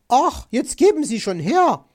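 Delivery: high, loud, exasperated demand; loud, impatient magpie. A narrator's reading. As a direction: demand it loudly, out of patience. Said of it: "forderte sie".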